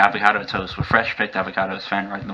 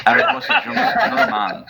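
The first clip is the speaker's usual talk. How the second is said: laughing. Real, not acted. Narrator laughing while reading.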